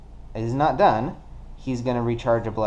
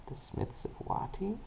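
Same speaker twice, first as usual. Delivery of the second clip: whispered name; 9 semitones higher, 14 dB quieter than usual. Acted. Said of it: "whispers the trader name".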